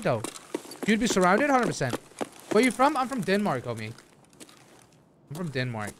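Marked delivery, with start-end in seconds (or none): none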